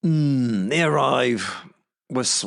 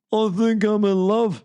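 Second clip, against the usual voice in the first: besotted bloodied idiot; 12 semitones higher than usual. Retold or imitated. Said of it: "he says".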